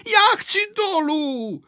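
high, drawn-out wail; panicked cry. A narrator's reading. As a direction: wail to be let off, high and slow. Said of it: "vykřikl Pepíček na Vojtu". (plačtivý dětský křik)

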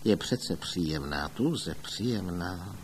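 low, soft grumble; quiet, deep musing aside; muttering to oneself. A narrator's reading. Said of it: "pak zabručel pro sebe".

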